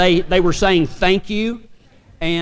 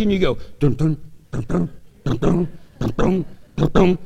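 bridge bump noises; 3 semitones lower than usual. Retold or imitated. Bumping over endless swamp bridges.

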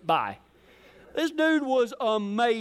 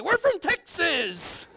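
higher, drawn-out proclamation; proud announcement; 7 semitones higher, slower than usual. Retold or imitated. Cowboy answers onlookers to his friend's chagrin, proud proclamation. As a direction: proclaim it with stretched-out, hammed-up pride. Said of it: "I'd say".

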